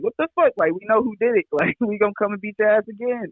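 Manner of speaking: high-pitched retold threat with a laugh; imitated outrage. Guest retells reaction to spray-painted driveway, high mock outrage. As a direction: jump way up in mock indignation. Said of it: "He was like".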